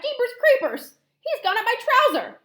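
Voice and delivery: loud, high-pitched outcry settling into determination; startled cook shouting. Acted. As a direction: shout in alarm, then rally the others. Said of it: "the cook shouted".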